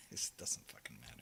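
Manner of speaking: quick, breathy whisper. Real, not acted. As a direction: toss it off in a whisper. Whispered dismissive aside.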